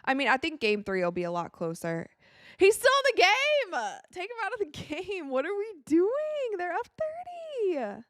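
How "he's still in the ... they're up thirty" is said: high, disbelieving cry about the star still playing; shocked fan yelling at the screen; high-pitched protest, can't believe it; incredulous outburst. Real, not acted.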